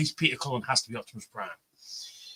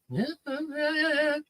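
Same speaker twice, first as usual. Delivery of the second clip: high, sing-song smug yeahs; boasting to friends at Spiders that he saw it, high and sing-song; 13 semitones higher, 4 dB quieter than usual. Retold or imitated. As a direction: tease them with a lilting yes. Smug confirmation.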